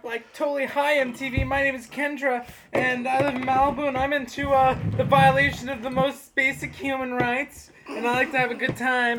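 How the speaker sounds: put-on high bubbly Valley Girl voice; Valley Girl accent. Shown as a demonstration. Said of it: "Valley Girl accent".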